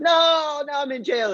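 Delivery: hammed-up despairing shout; mock wail. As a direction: wail it high like a wronged prisoner. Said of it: "And Joseph's like".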